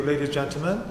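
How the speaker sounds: ordinary talk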